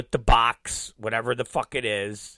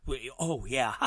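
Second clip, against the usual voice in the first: usual speaking voice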